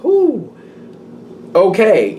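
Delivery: high surprised whoop; startled whoo. Retold or imitated. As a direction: react to a jolt, then collect yourself. Acting first hearing news.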